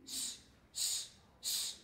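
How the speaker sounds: a voiceless hissed "s"; "S, s, s" whispered on its own; s sounds. Shown as a demonstration. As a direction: isolate "s" as a long, bare hiss. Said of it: "Swegles in Wuldra".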